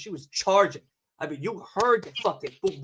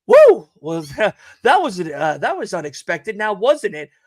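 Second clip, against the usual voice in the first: pilot on intercom; 7 dB louder than usual. Retold or imitated. Pilot on the intercom, whooping then casually laughing. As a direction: play the sheepish pilot laughing it off. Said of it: "he goes".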